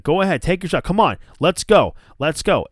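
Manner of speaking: retold angry challenge. Adam Cole losing it at Pat, loud angry dare. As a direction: dare him to swing, riled up. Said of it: "flips the fuck out on Pat McAfee".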